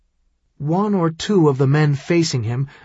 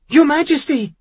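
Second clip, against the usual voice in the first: alarmed cry; 12 semitones higher than usual. A narrator's reading. Boy sees king bleeding.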